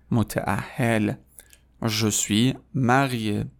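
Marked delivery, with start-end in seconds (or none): 1.8-3.5 je suis marié, very slow and even; slow model phrase. Shown as a demonstration. Teacher modelling for the class, very slow. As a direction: spell each syllable of marié out slowly. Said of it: "Répétez après moi".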